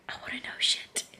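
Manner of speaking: hushed, secretive whisper; a breathless whisper of longing; whispered aside. Real, not acted. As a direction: whisper it like a guilty confession.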